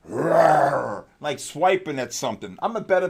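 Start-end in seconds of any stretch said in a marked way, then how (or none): 0.1-1.0 growled mock bear roar; a quick bear roar mid-sentence; lightly done bear roar. Shown as a demonstration. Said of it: "you gotta think of a bear".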